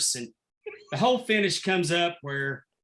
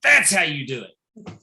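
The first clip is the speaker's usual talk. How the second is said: loud, slow mock scolding; retold dressing-down; 5 dB louder than usual. Manager showing his daughter a slap, loud retold scolding. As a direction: drive the lesson home, loud and slow. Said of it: "dressing her down".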